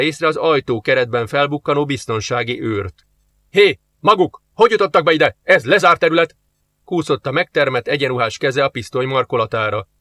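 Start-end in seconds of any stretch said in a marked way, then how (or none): 3.5-4.4 much louder, higher guard's challenge; guard shouting. Acted. Guard catches intruders. 4.5-5.4 louder, higher challenge; guard's demand. Acted. Guard asks twice. 5.4-6.4 much louder, higher guard's challenge; guard shouting. Acted. Guard catches intruders.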